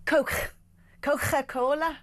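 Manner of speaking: "Coke, Coca-Cola" with throaty Scouse k after the vowel; hard first C, rasped middle k in "Coca-Cola". Shown as a demonstration. Presenter demonstrating Scouse, throaty middle k in "Coca-Cola". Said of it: "the C in the middle of the word becomes a K".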